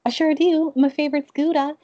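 high, loud cheery reply; cheerful answer. Retold or imitated. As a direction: chirp it out proudly. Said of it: "she's like".

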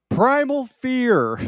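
drawn-out, high, deliberate answer; slow emphatic mock answer; slow deadpan guess. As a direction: stretch each word out slowly. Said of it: "was when he's like".

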